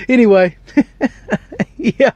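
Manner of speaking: high, laughing at himself. Real, not acted. Preacher catching his own joke, laughing sheepishly. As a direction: chuckle through a sheepish recovery.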